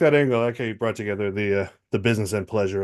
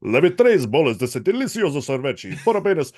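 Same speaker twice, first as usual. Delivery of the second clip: Portuguese supermarket announcer, higher; 5 semitones higher than usual. Retold or imitated. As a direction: announce the ice cream deal over the store speakers. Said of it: "supermarket announcer voice".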